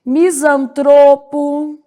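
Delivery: slow misantropo, mi-san-TRO-po; stress on TRO. Shown as a demonstration. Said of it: "a sílaba tônica".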